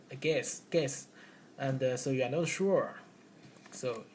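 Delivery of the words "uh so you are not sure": ordinary talk, nothing marked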